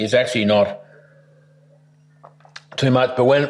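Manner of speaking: coughing on spicy food; choking on chili. Real, not acted. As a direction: talk through a chili cough. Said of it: "goes down the wrong way".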